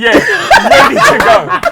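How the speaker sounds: can barely get the words out for laughing; laughing squeal. Real, not acted. Laughing hard, answering his mate about the midget. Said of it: "What, the midget?".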